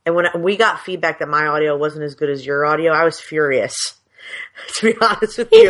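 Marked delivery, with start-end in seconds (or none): 4.3-5.7 laughing out the words; high, loud laughing confession. Real, not acted. Laughs about being furious.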